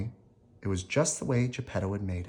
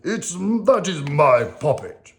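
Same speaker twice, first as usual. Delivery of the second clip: loud, slow villain protest; angry Stromboli; 6 semitones higher, 6 dB louder, slower than usual. Acted. Stromboli catching Geppetto with Pinocchio, loud and angry. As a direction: claim your puppet angrily, slow. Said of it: "he cried".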